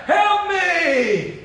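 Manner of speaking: high, drawn-out wail for help; crying out. Retold or imitated. Drinker begging to be rescued, high crying-out. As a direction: wail it like a desperate plea. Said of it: "he was crying out".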